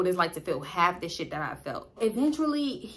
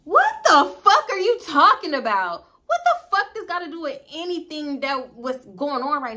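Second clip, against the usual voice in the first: retold angry yell; 6 semitones higher, 5 dB louder than usual. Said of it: "So I'm like".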